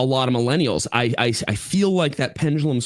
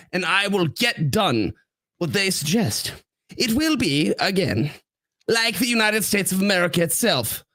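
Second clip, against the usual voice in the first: hammed-up presidential announcement, pitched way up; Trump impression; 7 semitones higher than usual. Reading a Trump post for his co-host, in a hammy Trump voice. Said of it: "You gotta do your best Trump accent".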